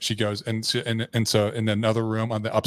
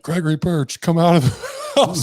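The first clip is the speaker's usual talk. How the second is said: high, loud imitation of police loudspeaker; mock police summons; loudspeaker announcement; 8 semitones higher, 7 dB louder than usual. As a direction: call out like police on loudspeakers, breaking into laughter. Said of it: "they got on loudspeakers".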